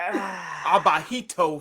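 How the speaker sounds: slow "abajito" said ah-bah-HEE-toh in Spanish; Spanish word. Shown as a demonstration. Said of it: "some would say".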